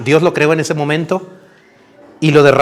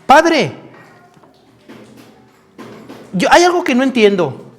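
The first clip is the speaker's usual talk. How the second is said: put-on high-pitched puzzled young son; little boy; 7 semitones higher than usual. Boy asks his father on the walk, high child voice. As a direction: play a small boy questioning his father. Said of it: "el muchachito le dice".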